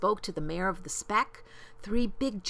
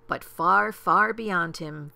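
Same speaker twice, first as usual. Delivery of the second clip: ordinary delivery, nothing marked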